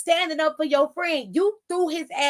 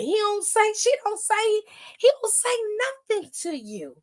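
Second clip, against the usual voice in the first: rising exasperation; 7 semitones higher than usual. Real, not acted. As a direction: voice climbs higher each "say". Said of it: "building rant".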